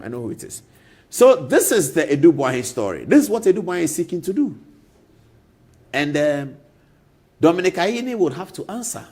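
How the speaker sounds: ordinary talk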